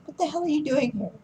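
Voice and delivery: high-pitched, quick mock outrage; imitating pissed-off shock. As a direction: act out an indignant, shocked daughter. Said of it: "pissed off slash shocked look".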